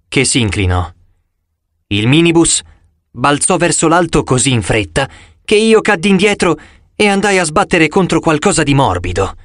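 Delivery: ordinary delivery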